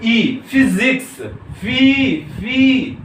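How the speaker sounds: high, slow ई, then "फिजिक्स" and "फी" drawn out; sounded-out vowel. Shown as a demonstration. Teacher urging students to try it, "फी" stretched high. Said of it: "कर लो इसको।".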